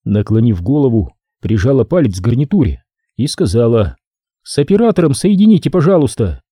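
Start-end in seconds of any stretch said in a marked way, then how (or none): 4.4-6.4 higher, fast headset request; brisk request. Acted. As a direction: quickly ask the operator. Sophie on headset.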